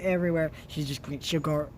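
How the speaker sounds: ordinary talk, nothing marked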